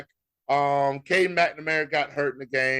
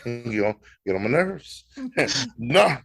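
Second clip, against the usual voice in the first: usual speaking voice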